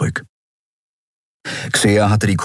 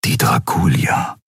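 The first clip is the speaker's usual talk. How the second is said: a lightly lowered murmur; the lion-man pondering the flagship; brooding thought; 6 semitones lower than usual. A narrator's reading. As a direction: let the name sink low and ominous. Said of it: "dachte Keru".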